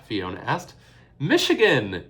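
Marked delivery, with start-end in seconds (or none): 1.2-2.1 high, loud, gleeful crow. A narrator's reading. Father crows the name of the new home. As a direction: crow the answer out in triumph. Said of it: "he crowed".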